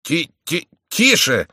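high, stuttering plea for hush; stammered cry. A narrator's reading. Husband hushing his furious wife, stammered high cry. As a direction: stumble on the first syllable, then cry it out. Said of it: "вскричал Евстахий".

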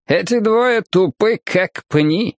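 high, tittering lady's voice; mock them in a high tipsy voice; drunken woman about to crack up; giggly drunk woman. A narrator's reading. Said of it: "прыснула со смеху женщина".

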